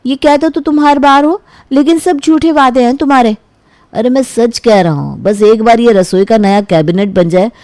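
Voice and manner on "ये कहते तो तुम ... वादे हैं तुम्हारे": put-on lightly raised, sulky young woman; a hint of an annoyed girlfriend; girlfriend's voice. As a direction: girlfriend accusing her boyfriend of excuses, mildly sulky.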